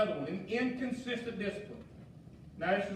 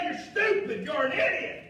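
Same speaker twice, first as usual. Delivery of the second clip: acted high-pitched angry outburst; angry name-calling; 5 semitones higher, 4 dB louder than usual. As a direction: hurl it like a parent losing their temper. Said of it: "call names in an anger manner, when we're mad".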